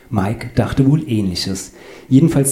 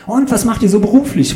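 very high, over-keen question; chirpy small talk; 13 semitones higher, 4 dB louder than usual. Acted. Claudius starts small talk with passengers, high and keen.

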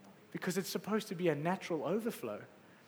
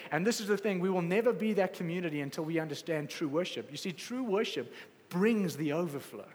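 usual speaking voice

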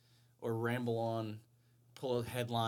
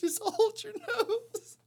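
high slow laughing; giggling advice; 5 dB louder, slower than usual. Real, not acted.

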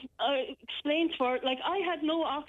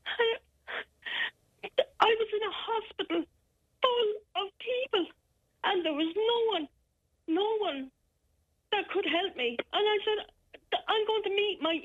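tearful; 7 semitones higher than usual. Real, not acted. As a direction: voice high and breaking with tears. Breaking down recalling the threat.

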